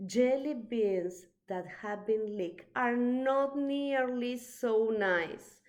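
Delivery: usual speaking voice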